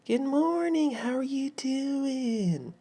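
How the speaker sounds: very high, sustained, hammed-up customer-service voice; elongated friendly greeting. Southern fast food worker greeting a customer, elongated over-friendliness. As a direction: draw every word out long and high. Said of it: "You make everything elongated with your".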